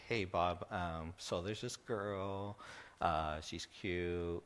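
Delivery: soft and slow, halting admission; quiet slow shy confession; nervous confession. Retold or imitated. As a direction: tiptoe into asking to date his daughter.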